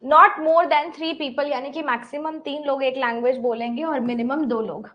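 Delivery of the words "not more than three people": reading the puzzle out slowly, word by word; slow question reading. Acted. Teacher reads the puzzle out slowly for her students. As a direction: dictate it slowly to the class.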